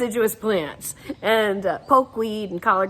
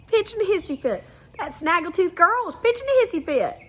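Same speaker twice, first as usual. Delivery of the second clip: gleeful; 10 semitones higher than usual. Acted. Savoring the Southern phrase.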